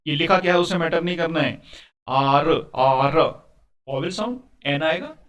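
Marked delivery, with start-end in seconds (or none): 2.1-3.2 "आर" drawn out, long "aa" before the r; letter-sound demo. Shown as a demonstration. Teacher showing the letter begins with a vowel sound, drawn-out "आर". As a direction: stretch "आर" so the opening vowel stands out. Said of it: "उच्चारण कहाँ से शुरू हो रहा है".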